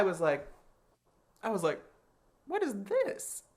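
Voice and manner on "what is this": baffled. Retold or imitated. Confused at the judges' silence.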